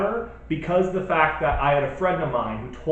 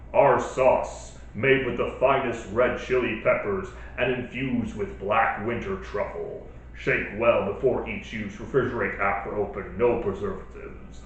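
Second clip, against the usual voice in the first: announcer voice; 5 semitones lower than usual. Acted. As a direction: read it like a fighting game announcer. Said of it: "read this in my announcer voice that I usually do".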